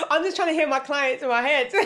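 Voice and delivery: high, loud laughing excuse. Real, not acted. Guest excusing her bad accent, laughing loudly. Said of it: "laughing at own accent".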